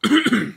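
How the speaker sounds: a nervous little throat clear; small hesitant ahem. Acted. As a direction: clear the throat before speaking. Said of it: "cleared his throat".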